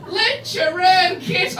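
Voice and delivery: "Lucha Kit" high, loud and very drawn out; puzzled cop lady. Retold or imitated. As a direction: drag out "Lucha Kit" in a mock officer's voice. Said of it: "And then she did this".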